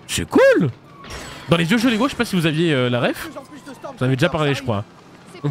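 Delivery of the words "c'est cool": excited. Real, not acted. Excited about the costume.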